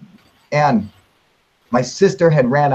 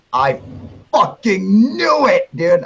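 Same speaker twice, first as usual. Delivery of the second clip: triumphant shout; 5 semitones higher, slower than usual. Retold or imitated. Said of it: "I started screaming".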